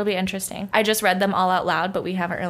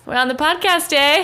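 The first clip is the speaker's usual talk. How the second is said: put-on loud, higher, jokey Canadian accent; mock Canadian accent; 7 semitones higher, 6 dB louder than usual. Host announces the podcast to her co-host in a mock Canadian accent. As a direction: play up a Canadian "eh". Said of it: "trying to speak with a Canadian accent".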